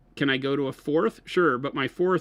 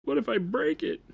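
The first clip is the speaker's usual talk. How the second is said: higher, lightly acted fretting; mock worry; 8 semitones higher than usual. Host teasing co-host about breaking models, mock worried. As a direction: voice someone else's worry in a higher pitch. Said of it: "you're worried about the".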